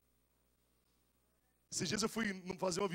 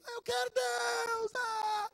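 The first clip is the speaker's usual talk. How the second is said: high, sobbing cry, drawn out slowly; 14 semitones higher, much slower than usual. Retold or imitated. Boy breaking down as the pastor prays, sobbing cry. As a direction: wail it through tears, much higher. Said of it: "ele caiu chorando".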